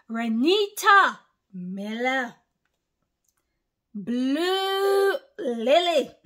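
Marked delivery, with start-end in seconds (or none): 3.9-6.1 high, slow, stretched-out name; drawn-out call. Real, not acted. Preacher stretching a follower's name high and slow. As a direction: sing out the name long and high. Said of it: "I'm gonna lose my voice over Blue Lily".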